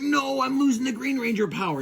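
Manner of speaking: high, wailing cartoon protest; hammed-up dismay; mock despair. Tommy as his Green Ranger power drains, mock wail.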